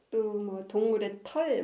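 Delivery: ordinary delivery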